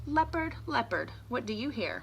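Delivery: sing-song call to the leopard; reading to children. Acted. Teacher reading aloud to kids, sing-song. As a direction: chant it to the little listeners.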